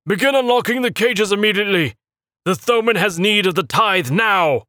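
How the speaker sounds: high, loud, commanding cultist voice; stern acolyte orders. A narrator's reading. Acolyte ordering Rowan to unlock the cages, high stern voice. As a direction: demand it right now.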